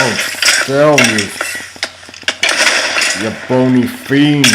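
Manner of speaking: loud, playful exasperation at an enemy; mock-outraged taunt. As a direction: curse the foe with hammed-up indignation.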